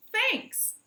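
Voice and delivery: high, loud goat reply; high nanny goat voice. Acted. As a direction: bleat the thanks. Goat answers farmer.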